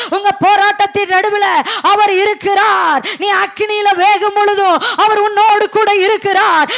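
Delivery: preaching that builds to a high, loud peak; impassioned preaching. Real, not acted. Preacher assuring weeping believers God is with them, building to full force. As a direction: drive each promise home, rising into a shout.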